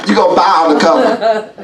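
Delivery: laughing hard at the undercover joke. Real, not acted.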